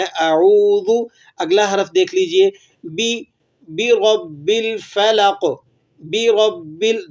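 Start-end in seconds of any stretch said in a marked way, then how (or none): 0.0-1.1 اعوذ drawn out slowly with a long oo; correct recitation. Shown as a demonstration. Teacher models the word for students. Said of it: "معروف پڑھنا ہے". 3.7-5.4 بی رب الفلق spelled out very slowly; slow recitation. Shown as a demonstration. Teacher models the phrase for repetition. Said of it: "دہرا لیجئے".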